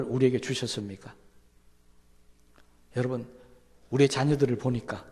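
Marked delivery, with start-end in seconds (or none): none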